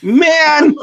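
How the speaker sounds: high-pitched, exasperated mock complaint; mock indignation. Co-host chiding host about non-drawing guests, mock indignation.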